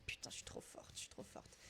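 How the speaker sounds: hushed, voiceless boast; whispered self-praise. Real, not acted. Quiz player congratulates herself on the salsa answer, whispered. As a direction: whisper it to yourself, very softly.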